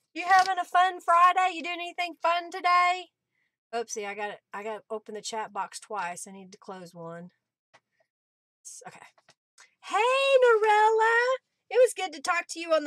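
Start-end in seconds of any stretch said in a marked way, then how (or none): none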